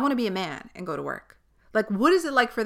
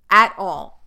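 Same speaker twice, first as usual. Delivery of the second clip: at all, said much louder and firmer; a sudden loud burst on "At all."; emphatic louder repeat; 9 dB louder than usual. Real, not acted.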